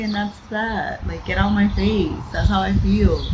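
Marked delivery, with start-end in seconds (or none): none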